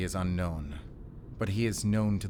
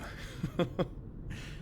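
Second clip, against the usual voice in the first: soft, airy giggle, pitched up; breathy giggle; 10 semitones higher, 8 dB quieter than usual. Acted. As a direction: giggle cheekily. Keeping the chocolate.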